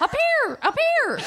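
very high falsetto call; Steve Martin. Retold or imitated. Imitating an actor alerting his friends, high falsetto. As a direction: call out in a piercing comic falsetto. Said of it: "in that falsetto".

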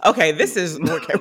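ordinary talk, nothing marked

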